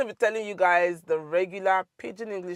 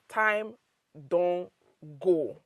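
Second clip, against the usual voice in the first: "Time don go" with a clear pause after each word; slower than usual. Shown as a demonstration. Teacher repeats "Time don go" for learners, word by word.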